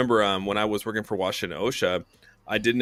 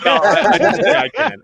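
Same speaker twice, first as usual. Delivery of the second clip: high, loud laugh through the words; 12 semitones higher, 10 dB louder than usual. Real, not acted. Admitting to his friends he only says it, laughing. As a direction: break up laughing mid-confession.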